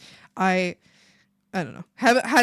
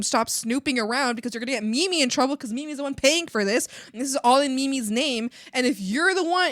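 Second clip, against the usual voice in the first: ordinary talk, nothing marked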